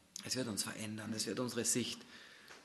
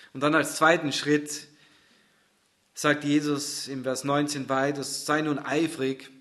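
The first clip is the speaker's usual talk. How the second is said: loud preaching; 4 semitones higher, 9 dB louder than usual. Acted. Preaching loudly, slow rallying.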